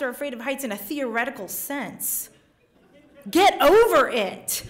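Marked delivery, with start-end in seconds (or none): 3.3-4.6 loud, slow brush-off; mock scolding. Telling the audience off, loud mock scold. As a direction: bark it at the audience.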